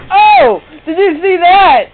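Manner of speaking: shrieking, very high and loud; excited yell. Real, not acted. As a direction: scream it in amazement. Amazed by a play on screen.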